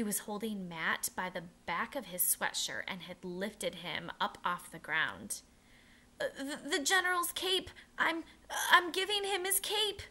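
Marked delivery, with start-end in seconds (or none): 6.2-10.0 high, loud, panicky stammering; frightened sputter. A narrator's reading. Boy caught by a captain sputtering an excuse, high and frightened. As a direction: blurt it out, caught and scared. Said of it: "Matt sputtered".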